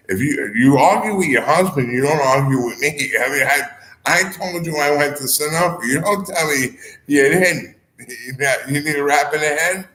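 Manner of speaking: retelling his comeback to the Sunoco woman with mock anger; mock scolding. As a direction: tell her off in a hammed-up mock anger. Retold heated comeback.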